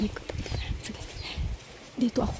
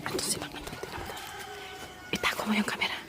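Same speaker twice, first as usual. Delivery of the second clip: whispering while hiding; 13 dB quieter than usual. Real, not acted. Sneaking up to prank, hiding.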